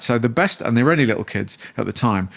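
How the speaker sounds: usual speaking voice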